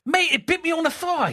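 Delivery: high hammed-up Australian accent; a mock Australian yelp of a bitten man; bad Australian accent. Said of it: "that's his Australian".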